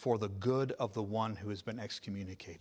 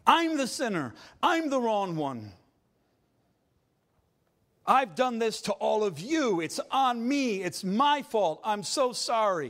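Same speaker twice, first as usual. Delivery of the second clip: higher, louder anguished confession; contrite cry; 9 semitones higher, 7 dB louder than usual. Acted. Truly repentant man confesses to the Lord, loud and pleading. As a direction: cry out for mercy. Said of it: "He says".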